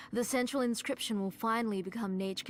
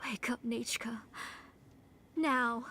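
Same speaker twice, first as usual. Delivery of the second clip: pleading whisper; 2 semitones higher than usual. Acted.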